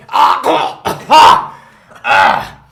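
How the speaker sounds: loud pained gasps; pained groans. Acted. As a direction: groan out each gasp in pain. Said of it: "you're just sitting there just going".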